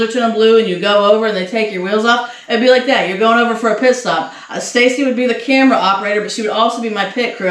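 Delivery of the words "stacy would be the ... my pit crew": usual speaking voice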